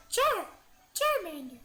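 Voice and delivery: very high, loud creature voice; Charmander. Acted. Streamer showing off Pokemon anime voices, high loud creature cry. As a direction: call out your own name as a tiny monster. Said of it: "anime voices".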